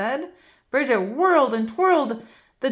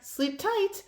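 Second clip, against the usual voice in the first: tender; 9 semitones higher, 6 dB quieter than usual. A narrator's reading. Zach soothing Bridget.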